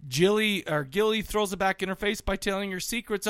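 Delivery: ordinary talk, nothing marked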